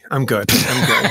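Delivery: laughing through the words out of character; breaking up laughing. Real, not acted. Giggling, can't keep it together. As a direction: actor cracks up after the scene ends, laughing. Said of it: "That's scene one".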